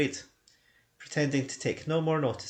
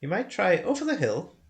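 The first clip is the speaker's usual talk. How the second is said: higher, louder hobbit shooing him off; fussy hobbit dismissal; 9 semitones higher, 4 dB louder than usual. Acted. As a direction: send him away politely but firmly. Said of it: "he said at last".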